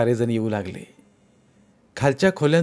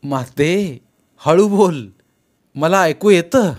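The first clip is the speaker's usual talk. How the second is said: raised, louder theatrical entreaty; mock-solemn plea; 5 semitones higher, 7 dB louder, much slower than usual. A narrator's reading. Doctor son begging his loud-talking mother, theatrical louder plea. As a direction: implore her with exaggerated, hands-folded politeness. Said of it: "हात जोडून म्हणाले".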